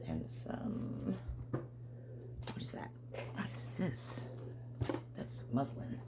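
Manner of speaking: soft unvoiced whisper. Real, not acted. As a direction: whisper as if sharing a secret. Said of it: "Why am I whispering?".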